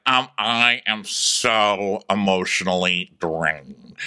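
low, very slow, weary drawl; Taylor. Acted. Telling Jill she is drained, low slow drawl. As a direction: drag out every word, drained. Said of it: "and Taylor's like".

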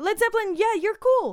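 high, enthusiastic quoted reply; acted approval. Band to Led Zeppelin while hanging out, acted approval. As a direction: imitate a band returning the compliment.

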